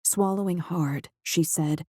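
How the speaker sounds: plain reading voice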